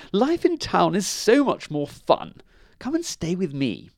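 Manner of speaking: high, animated sales pitch; boastful Town Mouse. A narrator's reading.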